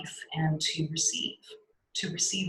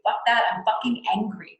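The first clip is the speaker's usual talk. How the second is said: higher-pitched, emphatic angry outburst; let-it-out anger; 5 semitones higher, 4 dB louder than usual. Shown as a demonstration. Teacher showing students how to let anger express, angry. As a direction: let the anger rip, pushing it out. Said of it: "So let it be angry".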